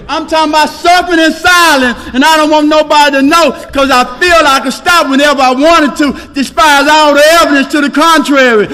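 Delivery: high, loud, driving pulpit delivery; shouting testimony from the podium to the room; full-force preaching. Acted. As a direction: preach it to the back row.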